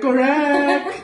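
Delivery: high, laughing cheer; excited correct. Real, not acted. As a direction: announce the winner with glee. Announces the right age guess.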